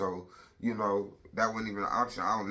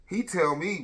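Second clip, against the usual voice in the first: ordinary talk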